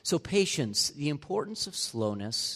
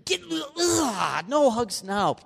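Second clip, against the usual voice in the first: much higher hammed-up shout of disgust; mock shout; 9 semitones higher, 4 dB louder, slower than usual. Pastor playing his wife shooing him away, mock shout. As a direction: yell him off in mock revulsion. Wife rejecting sweaty husband.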